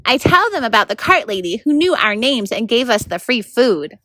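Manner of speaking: ordinary delivery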